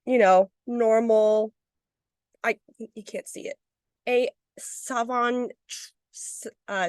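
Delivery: normal talking voice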